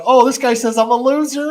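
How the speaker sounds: high, loud, gleeful report; giddy excitement. Retold or imitated. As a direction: announce it delightedly, about to laugh. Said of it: "I get all excited and I start laughing".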